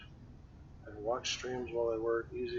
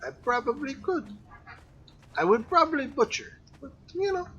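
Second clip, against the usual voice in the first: high squeaky voice; 14 semitones higher, 8 dB louder than usual. Acted. High put-on voice joking.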